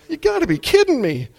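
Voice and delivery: high, loud disbelief; exasperated outburst. Shown as a demonstration. Preacher scolding fearful patients, high exasperated cry. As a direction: throw up your hands in disbelief. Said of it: "another emotional outburst".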